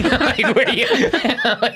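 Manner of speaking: high, laughing words; cracking up. Real, not acted. Host amused by the absurd R, cracking up. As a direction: struggle to talk through laughter.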